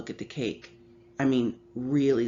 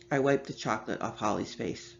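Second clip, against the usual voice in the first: usual speaking voice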